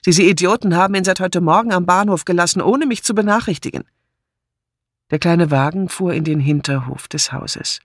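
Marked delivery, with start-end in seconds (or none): 0.1-3.8 fast, higher-pitched grumble; indignant. Acted. Martha complaining about the station staff, indignant. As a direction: rattle off the grievance.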